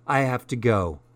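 ordinary delivery, nothing marked

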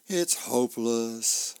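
slow, drawn-out sigh of defeat; hopeless. Acted. Sleepless husband to his wife in bed, hopeless. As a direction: give up wearily, stretching each word.

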